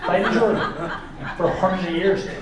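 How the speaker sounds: a lightly voiced, meandering Irish comic, laughing; Jimeoin impression. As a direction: ramble like a confused Irish comic. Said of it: "Jamoan could run Ireland, you know, and be like".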